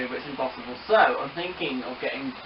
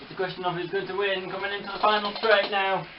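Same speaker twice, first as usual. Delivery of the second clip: racehorse commentator; 6 semitones higher than usual. Pretend. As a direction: announce the winner with a flourish. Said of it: "talk like a racehorse commentator".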